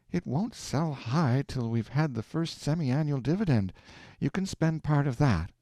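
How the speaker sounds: Aleck, prudent and kind; lightly voiced careful wife; sensible wife explaining. A narrator's reading.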